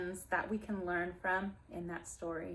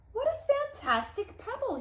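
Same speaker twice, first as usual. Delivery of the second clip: much higher, delighted cry; excited exclaim; 12 semitones higher than usual. Acted. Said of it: "he exclaimed".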